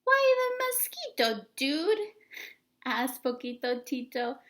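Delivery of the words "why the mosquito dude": very high, loud little voice; the tiniest dog asking; tiny Chihuahua voice. Acted. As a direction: squeak it like the smallest pup. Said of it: "the smallest of the small ones".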